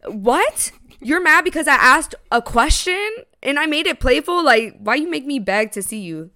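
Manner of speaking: incredulous but playful question; playful challenge. Retold or imitated. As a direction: replay it as a teasing protest. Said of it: "Is how I said it".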